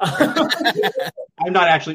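slow, laughing through the words; drawn out while laughing. Real, not acted.

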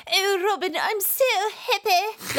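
high, prim mock-British Maid Marian voice; mock British accent. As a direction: swoon it out in an exaggerated British accent.

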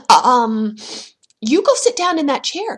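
stammer, then order it firmly; nervous command. A narrator's reading. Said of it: "commanded Jack".

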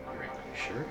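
soft unvoiced whisper. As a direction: breathe it as a whisper. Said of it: "And just whisper".

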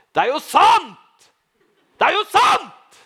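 high, loud ecstatic cry in church; ecstatic shout. Retold or imitated. Shouting in the church before a hundred and fifty people, high and loud. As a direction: cry it out, overwhelmed with joy. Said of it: "og ropte".

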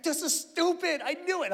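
stung son complaining, high and petulant; grumpy kid. Retold or imitated. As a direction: sulk like a stung boy. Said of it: "he's like".

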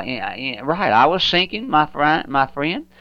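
ordinary delivery

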